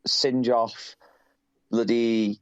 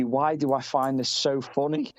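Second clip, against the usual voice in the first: normal talking voice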